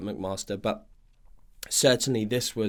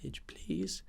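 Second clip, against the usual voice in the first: whispered mock-American dismissal, pitched up; American accent; 6 semitones higher, 10 dB quieter than usual. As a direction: breathe it out in a put-on American accent. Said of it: "I talk with an American accent".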